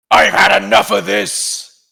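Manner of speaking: high, loud furious yell; angry shout. Acted. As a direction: roar it out in rage. Heckler bursts out at other table.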